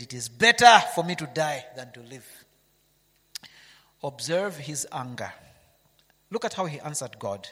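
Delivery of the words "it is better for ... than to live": Jonah crying out loud that he'd rather die; preacher voicing Jonah, loud; loud despairing cry. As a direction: belt out the wish to die.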